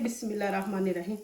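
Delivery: fast, soft under-the-breath bismillah; hushed quick blessing before flipping the paratha; murmured prayer. Real, not acted. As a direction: rattle it off quietly to yourself.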